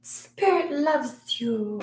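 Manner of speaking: slow, high, sugary mock greeting-card tone; mock sweet saying. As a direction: coo it like a cutesy deck card. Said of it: "I don't usually do the".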